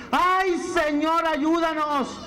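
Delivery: clamor desesperado. Retold or imitated. Clamor desesperado del pueblo.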